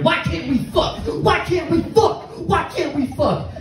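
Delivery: high-pitched imitation of a screaming patient; mock screaming. Psych ward patient being pulled out of a room, mock screaming. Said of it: "just screaming".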